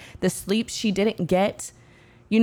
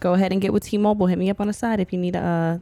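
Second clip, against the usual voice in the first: rushed mock commercial plug; fast ad read; faster than usual. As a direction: rattle it off fast like an ad.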